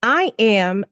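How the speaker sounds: usual speaking voice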